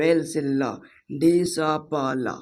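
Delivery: each sound of डी स प ल said slowly and separately; slow spelling out. Shown as a demonstration. Teacher sounds out "display" slowly for viewers. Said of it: "आधा स ... आधा प".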